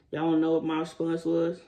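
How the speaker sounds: ordinary delivery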